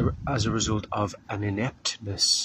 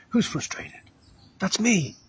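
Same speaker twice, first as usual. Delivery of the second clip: high, airy eager spirit voice; spirit of frustration; 10 semitones higher than usual. Retold or imitated. Preacher voicing the spirit of frustration, high eager call. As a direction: play a greedy spirit claiming its prey. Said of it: "Frustration goes".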